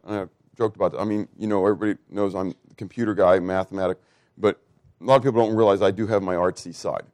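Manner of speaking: usual speaking voice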